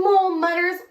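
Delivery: plain reading voice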